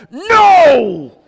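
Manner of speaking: put-on very high, loud kid's yell; child shouting no. Son yelling back at his dad, very high and loud. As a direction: bellow the no like an upset child. Son answering dad's question.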